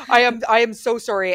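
mortified owner apologizing to the neighbour, loud; mortified apology. Retold or imitated. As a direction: apologize in a rush of embarrassment. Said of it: "poor mortified Nicole".

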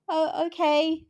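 high, hesitant "oh, okay"; taken aback. Retold or imitated. Reader recalling her first page shock, taken aback. Acts out startled first reaction.

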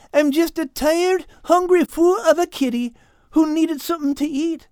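put-on stammering, accented apology; groveling. A narrator's reading. Cat Al begs forgiveness for wrecking the garden, groveling. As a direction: plead nervously, stumbling over the first word. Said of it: "groveled Al".

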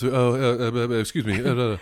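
flustered, stammering mumble; Hugh Grant. Retold or imitated. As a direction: stumble over a polite apology. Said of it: "He just kinda mumbles".

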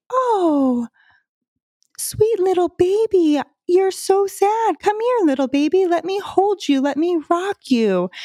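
put-on high sing-song baby talk; soothing a crying child in baby talk; motherese. Shown as a demonstration. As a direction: coo to a sad little baby. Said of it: "talking to them in motherese".

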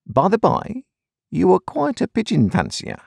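high, chirpy clerk voice; Wemmick's voice. A narrator's reading. Wemmick teasing the Colonel, high clerk voice. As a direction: lift the voice high for the wry clerk.

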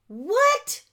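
high, loud, rising, astonished; can't believe the luck; amazed what. Real, not acted. Surprise at getting two rooms.

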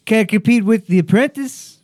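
mock Trump voice. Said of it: "I was like".

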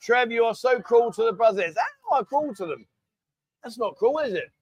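ordinary talk, nothing marked

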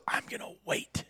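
hushed whisper so the antelope won't hear. Retold or imitated. Son whispering to his dad in the blind, whispered. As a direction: breathe the words out with no voice.

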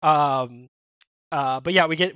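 normal talking voice

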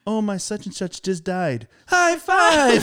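bright voice, high loud "high five"; cheerful texter. Retold or imitated. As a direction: chirp the high five gleefully. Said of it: "you're saying".

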